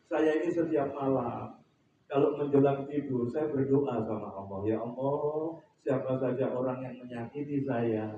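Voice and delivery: low, soft, slow retelling; hushed, unhurried quoted confession; humble bedtime prayer. Retold or imitated. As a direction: recount a private prayer humbly and quietly.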